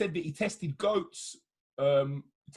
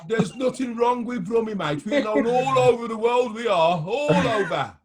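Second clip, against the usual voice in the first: loud, proud Brummie accent, pitched up; 6 semitones higher, 7 dB louder than usual. Real, not acted. Defending Brummies to the host, loud broad Brummie accent. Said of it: "starting to sound like a yam yam there".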